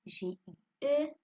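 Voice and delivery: slow, loud letter-by-letter spelling, then the word; teacher's emphatic spelling drill. Shown as a demonstration. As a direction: spell it out deliberately for a class, then say it firmly.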